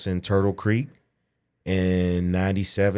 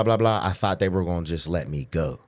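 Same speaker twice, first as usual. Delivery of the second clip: ordinary talk, nothing marked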